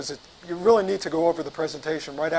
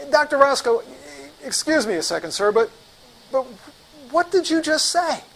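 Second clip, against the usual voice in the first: baffled; 7 semitones higher than usual. Retold or imitated. Baffled rep calls after departing doctor.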